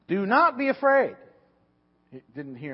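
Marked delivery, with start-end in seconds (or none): 0.1-1.1 loud, high, proclaimed command; emphatic proclamation. Acted. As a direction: boom it out like an angel. Angel's words read from scripture.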